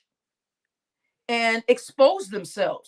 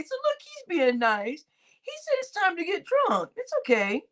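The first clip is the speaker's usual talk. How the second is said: usual speaking voice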